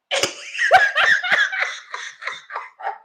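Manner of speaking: very high shrieking laughter. Real, not acted. Speaker cracking up at the agent, shrieking laugh. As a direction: burst into a high cackle.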